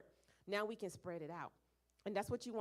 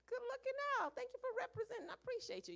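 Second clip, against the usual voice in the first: much higher, bright call to a team member; upbeat shout-out. Retold or imitated. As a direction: hype up your teammate on the phone. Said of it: "I'll call them up and be like".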